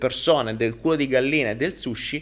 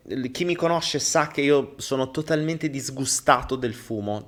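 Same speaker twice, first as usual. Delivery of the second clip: ordinary delivery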